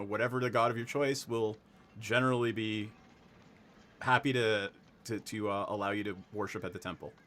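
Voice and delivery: ordinary talk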